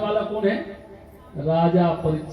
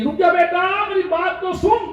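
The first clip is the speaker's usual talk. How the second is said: very high-pitched cry after someone; calling out; 12 semitones higher than usual. Acted. Vyas calls after his departing son, crying out high. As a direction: shout it like a father calling a runaway back. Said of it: "व्यास जी चिल्ला रहे".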